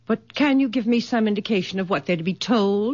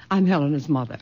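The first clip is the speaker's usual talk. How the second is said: usual speaking voice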